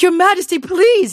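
high, loud begging; pleading farmer. Acted. Farmer pleading with the king, high and desperate.

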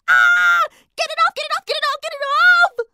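high, loud frantic shrieks; panicked screaming. Acted. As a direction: scream to get it off. Donut stuck on nose, panic.

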